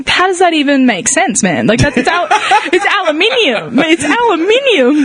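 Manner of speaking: hammed-up Aussie accent, slowing on the last words; broad Australian accent. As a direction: argue in exaggerated Australian, drawing out aluminium. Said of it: "I literally talk to my family like that".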